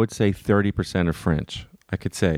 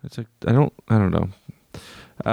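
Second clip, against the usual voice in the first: ordinary talk, nothing marked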